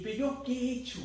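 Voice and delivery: reclamo agudo de enojo imitado; enfurecido, relatado. Retold or imitated. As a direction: recrea una protesta frustrada, con la voz alta. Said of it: "estaba enfurecido". (reclamo enojado en tono agudo)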